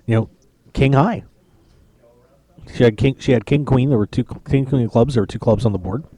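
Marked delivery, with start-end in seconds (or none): none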